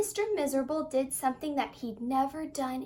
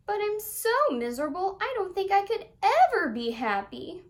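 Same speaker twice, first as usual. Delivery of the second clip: high, louder self-pitying whine; a higher, louder whining voice, full of self-pity; miserable; 7 semitones higher, 6 dB louder than usual. A narrator's reading. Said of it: "added miserably".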